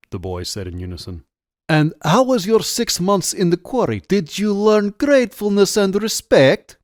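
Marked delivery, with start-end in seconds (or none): 1.7-6.8 put-on high-pitched, taunting Russian-accented questioning. A narrator's reading. Officer taunts boys about the quarry, in a Russian accent. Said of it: "he asked in a heavy Russian accent".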